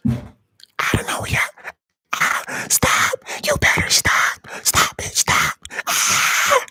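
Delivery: forceful stage whisper. Pretend. Playfully demanding that the whispering stop, in a whisper. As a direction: hiss it out without any voice.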